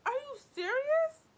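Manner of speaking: high, loud, drawn-out disbelief; hammed-up appalled reaction; mock outrage. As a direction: yelp it slowly in exasperation.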